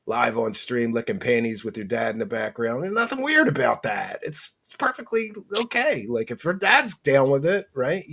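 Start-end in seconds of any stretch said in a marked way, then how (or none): 2.8-8.1 sarcastic. Acted. Sarcastic mock reassurance, repeated.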